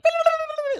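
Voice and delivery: very high delighted squeal-laugh; giddy squeal. Real, not acted. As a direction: squeal with glee.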